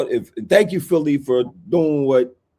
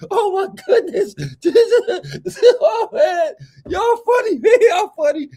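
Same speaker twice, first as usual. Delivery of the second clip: laughing hard; 4 dB louder than usual. Real, not acted. Laughing at rivals.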